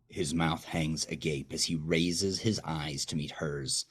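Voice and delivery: ordinary delivery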